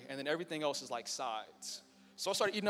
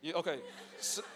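normal talking voice

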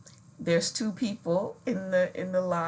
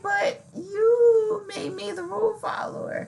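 high, whiny protest; mock whine; 12 semitones higher, 4 dB louder than usual. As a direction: complain like a hurt child. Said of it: "And I was like".

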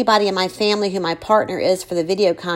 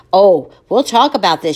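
loud, ominous promise; grim threat; 6 dB louder than usual. Acted. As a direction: make it a threat. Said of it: "she agrees".

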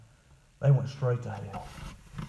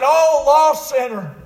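loud, long drawn-out preacher's call; retold crying out; 14 dB louder, much slower than usual. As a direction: call out slowly to the lost. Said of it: "And then he cries out".

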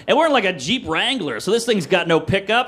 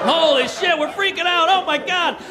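high-pitched mock hysteria; screaming like schoolgirls; 6 semitones higher than usual. Teens stuck in the shaken car, hysterical schoolgirl panic. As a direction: squeal it in acted panic. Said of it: "screaming like, like little schoolgirls".

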